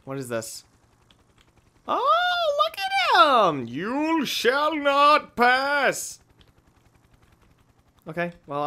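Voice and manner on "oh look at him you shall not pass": very slow, high, dramatic Gandalf-style line; hammed-up wizard boom. Gamer hams up a wizard line at the character. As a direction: stretch it out like a mock wizard.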